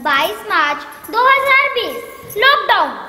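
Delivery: dramatic loud announcement. Acted.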